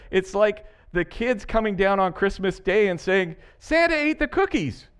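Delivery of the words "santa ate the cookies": put-on much higher childlike announcement; hammed-up child voice; excited kid.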